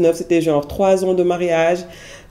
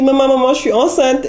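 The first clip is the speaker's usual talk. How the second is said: high, louder calling out mum; excited announcement; 6 semitones higher, 4 dB louder than usual. Retold or imitated. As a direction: burst out the news to mum. Calling mother with news. (annonce excitée à sa mère, voix aiguë)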